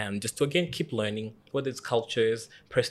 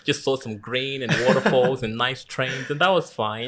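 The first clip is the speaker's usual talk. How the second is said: laughing; 3 semitones higher, 7 dB louder than usual. Real, not acted. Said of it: "laughing while describing Switzerland".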